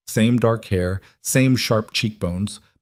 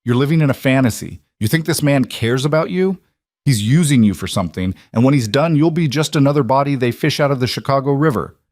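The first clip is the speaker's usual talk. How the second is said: angry; 6 semitones higher, 3 dB louder than usual. Acted. Maya's furious warning.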